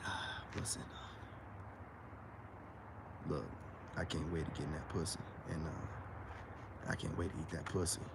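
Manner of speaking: very low, hushed bedroom murmur. Acted. Man sweet-talking a woman with dirty talk, very low and hushed. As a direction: drop deep and soft, like a smooth seducer.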